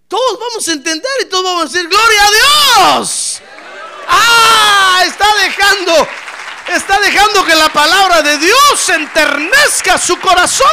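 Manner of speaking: "Gloria a Dios" shouted in fervour; shouted praise. Acted. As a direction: cry it out to the whole church. Preacher shouting praise climax.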